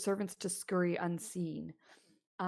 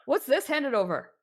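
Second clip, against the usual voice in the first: burly guard demanding, louder; gruff guard; 6 semitones higher, 10 dB louder than usual. Acted. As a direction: challenge the messenger. Burly guard questions messenger.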